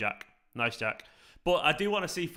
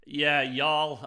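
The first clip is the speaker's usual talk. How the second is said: a hammed-up Texan y'all, a touch louder; mock Texan accent; 5 dB louder than usual. Mocking the Texan y'all to chat in a Texan accent. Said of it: "I don't like the y'all thing".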